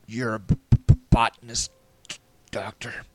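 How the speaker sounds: shivering, stuck on explosive b sounds; teeth-chattering stutter. Acted. Rescuer correcting the doctor in the cold, shivering stutter. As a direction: stammer through chattering teeth. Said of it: "my teeth chattering".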